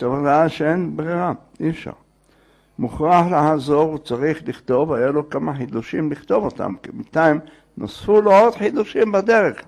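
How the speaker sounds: ordinary delivery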